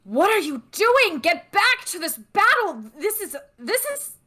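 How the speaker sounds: high-pitched, indignant battlefield yelling; hammed-up scream at retreating troops; screaming in outrage. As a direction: cry out in high, acted outrage at deserters. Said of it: "they're screaming".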